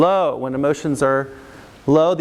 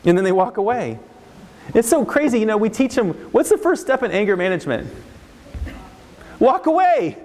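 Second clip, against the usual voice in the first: ordinary delivery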